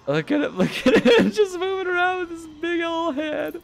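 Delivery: high giggling through words; crying with laughter; laughing. Real, not acted. Gamer watching the big head bob, giggling high.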